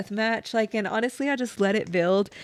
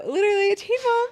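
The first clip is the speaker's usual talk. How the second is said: laughing; 12 semitones higher, 3 dB louder than usual. Real, not acted. Joking about being teen mom.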